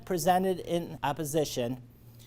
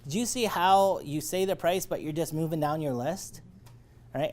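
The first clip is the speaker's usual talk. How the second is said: usual speaking voice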